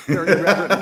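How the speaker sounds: laughing through the words. Real, not acted. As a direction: chuckle as you admit it.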